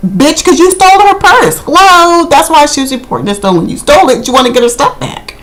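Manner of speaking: sassy inner retort. Retold or imitated. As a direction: talk back at the screen, exasperated. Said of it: "and I'm thinking".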